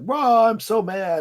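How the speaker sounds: high, slow, hammed-up grumble of rage; mock angry Raphael. Turtle ranting after master's kidnapping, mock angry high voice. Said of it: "Raphael went on this, like".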